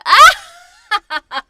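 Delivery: high, loud shriek into a cackle; Laverna. Retold or imitated. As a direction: imitate a villainess's screeching evil laugh. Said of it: "'cause Laverna's previously gone".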